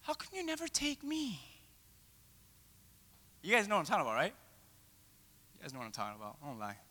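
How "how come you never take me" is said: higher, soft pleading; whiny plea. Retold or imitated. Left-out disciples pleading softly with Jesus. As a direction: beg to be included. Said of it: "disciples saying".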